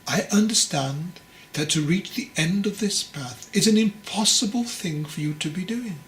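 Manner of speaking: ordinary delivery, nothing marked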